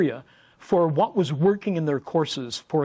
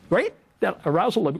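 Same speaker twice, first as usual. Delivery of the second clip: usual speaking voice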